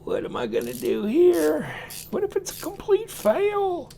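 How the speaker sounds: high, fretful inner voice; mock panic at taking it apart; worried self-talk. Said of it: "then you go".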